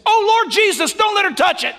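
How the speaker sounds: high, loud urgent plea to heaven; desperate cry to God. Retold or imitated. Preacher retelling his roadside prayer, high urgent plea. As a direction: cry out to the Lord in panic.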